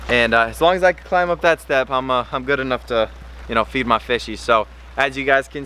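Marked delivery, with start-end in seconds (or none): none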